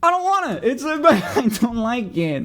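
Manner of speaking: high, giggling whine; laughing refusal. Real, not acted. Refusing food, childish.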